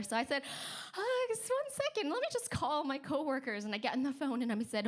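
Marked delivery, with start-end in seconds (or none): 0.9-3.6 high-pitched customer-service composure; holding it together. Retold or imitated. Receptionist stalls guest while freaking out, high forced composure. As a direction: cover panic with a high, polite front. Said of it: "just kind of hold it all together".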